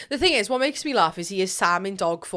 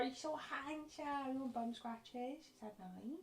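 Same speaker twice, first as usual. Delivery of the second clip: cooing at the dog; 19 dB quieter than usual. Real, not acted. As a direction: sweet-talk while scratching him.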